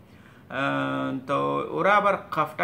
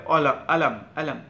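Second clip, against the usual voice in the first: আলাম with the k dropped to a glottal stop; Egyptian pronunciation; 4 dB louder than usual. Retold or imitated. Teacher imitating Egyptians saying কলম, dropping the k. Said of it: "এরা বলবে".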